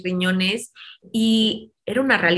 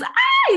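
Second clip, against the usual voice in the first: alarmed cry; 3 dB louder than usual. Retold or imitated. Nurses react in alarm.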